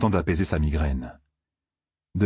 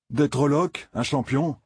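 mild bitterness; 7 semitones higher than usual. A narrator's reading. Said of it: "d'un ton amer".

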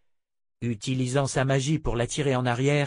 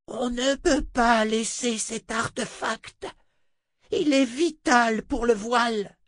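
insistent sorceress voice; 11 semitones higher, slower than usual. Acted. Adi insists on artefact.

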